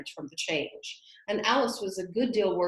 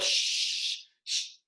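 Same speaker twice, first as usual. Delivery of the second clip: shushing. A narrator's reading. Hushing Alice.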